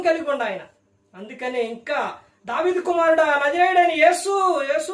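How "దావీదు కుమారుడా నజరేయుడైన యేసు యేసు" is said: loud, higher plea to Jesus; crying out loud. Retold or imitated. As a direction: call out desperately across the crowd. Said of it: "అని కేకలు వేసిండు". (గట్టిగా కేకలు వేస్తూ)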